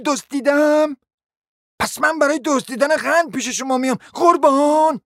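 high-pitched outraged accusation; indignant cry. A narrator's reading. Lady confronting Maria over sugar theft gossip, indignant cry. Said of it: "فریاد برآورد".

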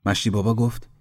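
plain reading voice

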